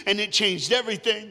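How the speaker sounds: ringing preacher's line. Acted. Preacher declaring to the church, loud and rising. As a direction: proclaim it to the whole church. Preacher building, then softening.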